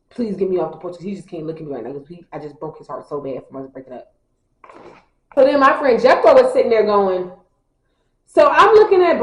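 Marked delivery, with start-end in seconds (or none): 0.1-4.1 much softer, quicker, mockingly dramatic despair; mock heartbroken. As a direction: play his heartbreak as a soft mocking lament. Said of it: "He can't look at me right now".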